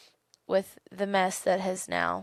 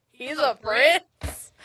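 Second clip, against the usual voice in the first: drawn-out, higher, louder disbelief; mock astonishment; 6 semitones higher, 6 dB louder, much slower than usual. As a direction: stretch it out in retold incredulity. Retold shocked reaction to news.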